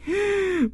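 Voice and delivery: laughing up high. Real, not acted. Laughing at the scene.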